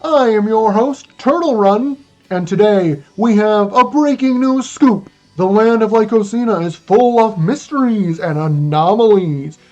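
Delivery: put-on booming old-fashioned newsreel announcer; old-timey news anchor. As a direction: broadcast it like a 1940s newscaster. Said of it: "speaking in an old-timey news anchor voice the entire time".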